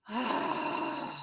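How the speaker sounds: soft, whispery exhale; voiceless releasing sigh; long sigh. Real, not acted. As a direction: breathe out slowly and softly. Exhales after deep breath cue.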